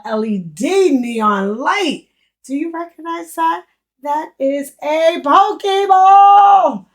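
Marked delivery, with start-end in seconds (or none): 4.0-6.9 loud, slow, high delighted cry; thrilled to spot a Pokeball; excited shout. Real, not acted. To the viewers, shouting out a Pokeball with joy. Recognizing a Pokemon light.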